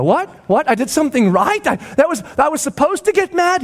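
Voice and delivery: high-pitched disbelieving questions; astonished kid. Acted. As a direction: react like a child amazed at praise. Child amazed at being praised.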